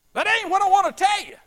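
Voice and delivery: high, loud insistent correction; excited. Retold or imitated. Widow cutting off the preacher, excitedly insisting. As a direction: burst out, eager to get to the point. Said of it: "she was just excited".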